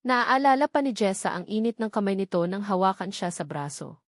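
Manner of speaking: normal talking voice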